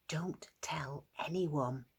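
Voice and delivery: slow, breathy whisper. Acted. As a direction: whisper it like a secret. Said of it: "whispered Jesus".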